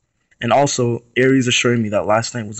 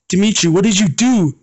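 higher-pitched horrified demand; 8 semitones higher than usual. Acted. Aries confronting Dimitri after the crash, horrified high cry. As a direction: cry out in shock at what he did. Accusing after friend killed.

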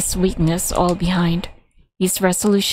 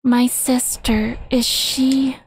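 put-on faint, slow young girl; weak girl voice; 5 semitones higher, much slower than usual. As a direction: ask weakly, almost out of breath. Dying girl asks about sister.

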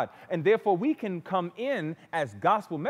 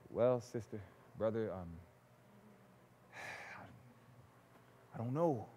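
soft, much lower, unsure mumble; mock hesitant murmur; 7 semitones lower, 11 dB quieter than usual. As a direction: imitate a doubtful visitor trailing off. Mock hesitant bedside visit.

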